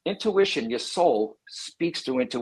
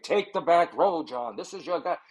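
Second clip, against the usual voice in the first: spirit guide voice. Acted. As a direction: announce it like a mock spirit guide. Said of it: "not like a guide".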